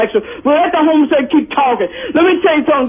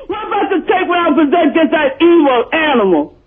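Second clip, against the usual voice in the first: furious rant. Real, not acted. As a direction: roar it out, hating every word.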